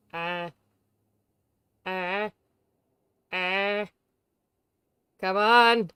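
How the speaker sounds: fed up with the stream tech, let it out; frustrated. Real, not acted. Frustrated at broken chat.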